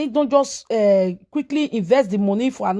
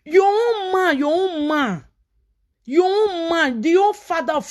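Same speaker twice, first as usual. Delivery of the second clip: high, stressed repetition of own; incredulous reproach; 5 semitones higher than usual. Real, not acted. Reproaching her for her own man, incredulous. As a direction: press each "own man" harder.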